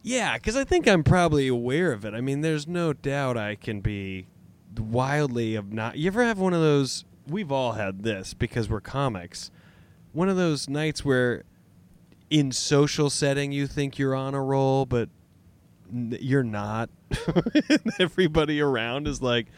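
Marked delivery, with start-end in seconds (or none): none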